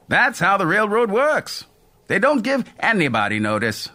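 put-on a friendly old conductor shrugging it off. A narrator's reading. Mel explains railroad ways.

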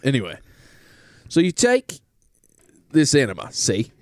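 hammed-up British pitch to the lads; British salesman. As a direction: sell it like a cheeky British huckster. Plays a British Goop salesman.

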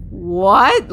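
loud, indignant what; angry what. Retold or imitated. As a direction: fire it back in outrage. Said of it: "Like, I was so angry".

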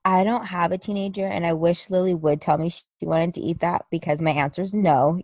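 ordinary talk

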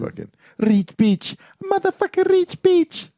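very high squeaky rapper voice. Retold or imitated. Quoting Die Antwoord song.

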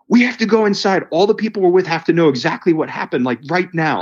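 can't get inside fast enough; ecstatic. Retold or imitated. Insisting the group hears the story now, ecstatic rush. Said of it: "I'm so just ecstatic".

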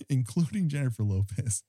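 ordinary talk

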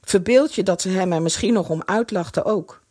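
ordinary delivery

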